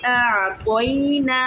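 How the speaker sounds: आ तो इना with the आ and ई stretched one alif long; lengthened vowels. Shown as a demonstration. Teacher shows the girls the long vowels in आ तो इना. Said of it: "आराम से अदा करेंगे".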